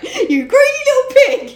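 high, loud mock scold of a pig. Friend mocking the greedy pigs, high mock scolding. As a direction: sneer it out in a high put-on voice. Quoting a character in character voice.

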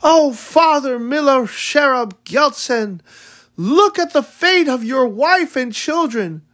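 high, pleading wail to her dead husband; crying mother. A narrator's reading. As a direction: cry out to the dead husband in despair. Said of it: "my mother cried".

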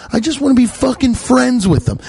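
ordinary talk, nothing marked

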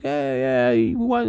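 high-pitched imitation of an old man; older gentleman. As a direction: play an eager old ice cream vendor calling out. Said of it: "he was an older gentleman".

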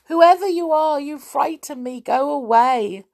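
put-on high, loud frightened boy; frightened cry. A narrator's reading. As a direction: beg the unseen voice to leave. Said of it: "cried Len".

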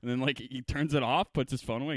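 usual speaking voice

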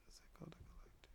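quick unsure whisper to self. Real, not acted. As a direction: breathe the title fast, unvoiced. Whispering to himself.

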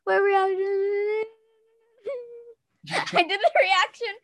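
helpless real laughter while talking; laughing hard. Real, not acted. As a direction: barely get the words out for laughing. Cracking up at failed tongue twister.

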